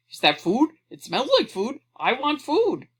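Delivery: eager dog voice, climbing high and loud; barking dog. A narrator's reading. Dog running after children with groceries, barking excitedly. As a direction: bark it out like a food-mad dog.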